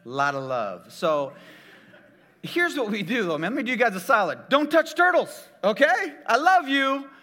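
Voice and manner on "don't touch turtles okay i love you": loud, high earnest warning; preacher urging the crowd. Real, not acted. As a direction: plead with the audience. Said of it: "earnest advice".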